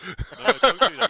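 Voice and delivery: shout. Real, not acted. Stopping mate changing smoke.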